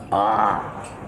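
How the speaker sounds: ordinary talk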